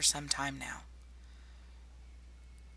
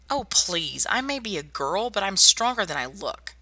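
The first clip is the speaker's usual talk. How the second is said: indignant; 5 semitones higher, 6 dB louder than usual. Acted. Scoffing at the patient's doubt.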